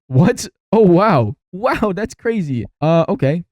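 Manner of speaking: real surprise, drawn out at the end; startled disbelief. Real, not acted. Player hears the coffin request, astonished and high-pitched.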